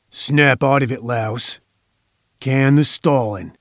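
coarse tough guy, mildly acted; a touch rougher, impatient order. A narrator's reading. Thug ordering a dazed captive to come round, roughly. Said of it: "rougher, coarser".

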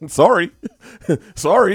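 high, loud word bursting through laughter; cracking up mid-sentence; laughing. Real, not acted. Laughing at own hypothetical.

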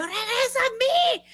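very high, slow, melodramatic cry; hammed-up plea to bring someone back; frantic panic. Woman slapping her unconscious friend awake, frantic. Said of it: "en loca, o sea, yo me asusté mucho".

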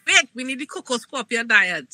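ordinary talk

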